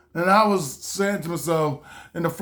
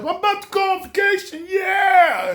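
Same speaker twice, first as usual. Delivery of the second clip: hyped-up shout; 11 semitones higher than usual. Retold or imitated. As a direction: shout it like vacation just started. Hyped at the airport.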